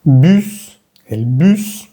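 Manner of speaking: bus as "büs", rounded ee; careful French-vowel bus. Shown as a demonstration. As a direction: pucker on the u of bus. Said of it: "la diferencia en la U".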